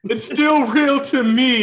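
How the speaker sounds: high, loud, slow fake-tearful fan; mock tearful wrestling fan; mock sobbing fan. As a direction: ham up the weeping superfan. Quoting famous wrestling fan meme.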